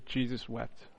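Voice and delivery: barely above a murmur, reverent; hushed and simple. Shown as a demonstration. Preacher reading the shortest verse aloud, hushed and reverent. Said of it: "as it says simply".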